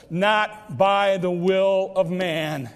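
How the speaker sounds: slow deliberate scripture reading; slow solemn note. Acted. Evangelist writes third note.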